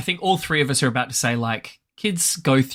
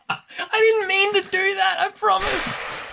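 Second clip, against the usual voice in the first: laughing. Real, not acted. Laughing apology.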